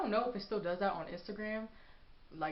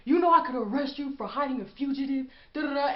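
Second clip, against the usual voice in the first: rude cop; 6 semitones higher, 9 dB louder, faster than usual. Retold or imitated. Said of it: "rude as hell".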